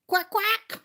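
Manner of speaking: a light, high mock quack; playful duck. Making duck lips at the camera, light quacking. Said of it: "says the duck".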